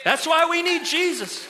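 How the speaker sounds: loud, high proclamation; emphatic preaching shout. Real, not acted. Preacher driving home the gospel to his congregation, emphatic shout. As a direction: proclaim it at full voice to the room.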